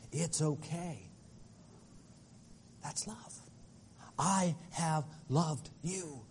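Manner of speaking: put-on soft, slow and hushed, like comforting a child; barely above a breath, tender; tender whisper of love. Tender reassurance, then repeated louder.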